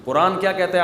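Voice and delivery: ordinary talk, nothing marked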